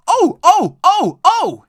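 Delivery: very high, very loud squeals; screaming trucks. A narrator's reading. Silly trucks bumped by Edward, screaming high. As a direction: shriek like startled trucks. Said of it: "screamed the trucks".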